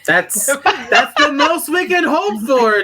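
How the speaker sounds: high, slow, through laughter; laughing agreement. Real, not acted. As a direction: say it while cracking up. Laughing reply about the ending.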